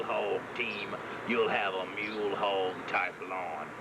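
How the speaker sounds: low, gruff mule character. Acted.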